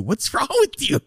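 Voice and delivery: high, incredulous repeat; shocked friend. Retold or imitated. A friend reacting to something gross, high and shocked. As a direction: play the horrified onlooker. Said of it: "see that and go".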